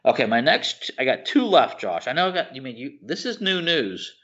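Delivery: ordinary talk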